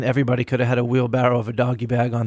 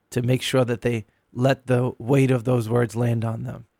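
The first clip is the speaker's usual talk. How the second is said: usual speaking voice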